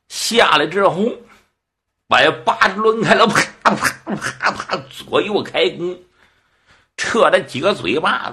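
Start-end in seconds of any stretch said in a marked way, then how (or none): 3.3-4.8 rapid-fire slap noises made by mouth; voiceless popping "pa" sounds for slaps; imitated slapping. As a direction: act out a flurry of slaps. Imitates a flurry of face slaps.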